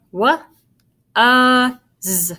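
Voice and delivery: "wa, uh, z" said as three separate sounds; sounding out. Shown as a demonstration. Teacher breaking a sight word into its sounds, sounding out. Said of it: "It actually sounds like a U".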